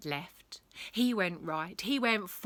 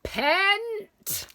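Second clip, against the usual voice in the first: high, loud headmistress's yell; drawn-out, piercing summons; shouting; 9 dB louder than usual. A narrator's reading. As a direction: shout it down from a top window. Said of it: "poked her head out and shouted".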